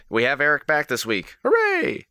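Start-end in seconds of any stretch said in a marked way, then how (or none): 1.4-2.1 high squealing cheer. Real, not acted. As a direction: whoop with delight. Host cheering returning player.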